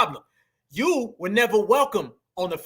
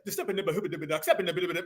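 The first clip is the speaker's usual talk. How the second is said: mock Jamaican babble; 4 semitones lower, 7 dB quieter than usual. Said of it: "with a fake ass accent".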